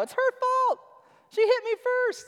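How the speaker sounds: put-on very high, tattling child voice; whiny toddler. Preacher imitating his toddler blaming a sibling. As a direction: squeak it like a kid tattling on a sibling. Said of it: "what our toddlers do".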